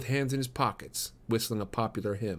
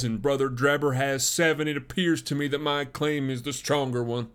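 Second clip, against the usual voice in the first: higher, firm formal petition; assertive young suitor; 4 semitones higher, 4 dB louder than usual. Acted. Suitor asking a father for his daughter's hand, assertive. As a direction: press your claim formally. Stangerson presses his claim.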